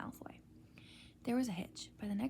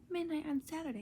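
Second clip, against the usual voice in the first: hoarse, higher panicked voice; 6 semitones higher than usual. A narrator's reading. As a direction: rasp it out in sudden alarm. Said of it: "he said in a hoarse voice".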